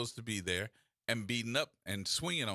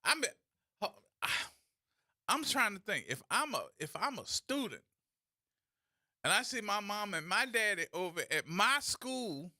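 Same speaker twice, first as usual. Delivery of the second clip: usual speaking voice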